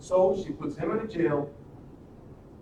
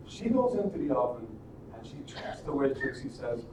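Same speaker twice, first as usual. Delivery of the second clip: ordinary delivery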